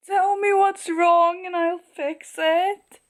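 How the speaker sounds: high, slow, gushing quote; overcome read-aloud. Retold or imitated. Reader swooning over the hero's promise, overcome. Moved reader reads favourite line.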